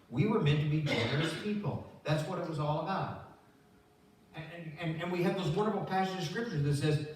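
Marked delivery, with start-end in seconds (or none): none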